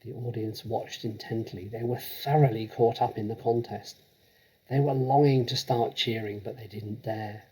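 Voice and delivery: plain reading voice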